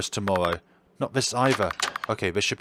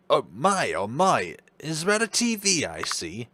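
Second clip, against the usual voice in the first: higher, delighted doctor voice; raised-pitch doctor admiring the room; doctor character voice; 7 semitones higher than usual. Acted. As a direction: gush about it like the genteel doctor. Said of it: "I'm gonna drop this voice".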